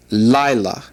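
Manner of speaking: "lila" with the accent on its first syllable; stress on first syllable. Shown as a demonstration. Teacher reads out "lila" for the class, first syllable stressed. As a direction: stress the opening of "lila", ending lighter and falling. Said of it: "accent has taken the emphasis off".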